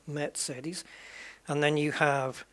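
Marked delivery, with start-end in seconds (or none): 0.1-0.8 Mercedes with ts, mairt-SAY-des; German way. Shown as a demonstration. Gamer repeating the home-country way, Mercedes with ts. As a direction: say Mercedes softly with a ts in the middle. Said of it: "it's quite similar".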